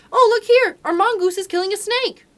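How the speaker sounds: put-on excited boy shouting for his parents; child's shout. A narrator's reading. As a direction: yell it across the garden to the house. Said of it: "Teddy shouted to the house".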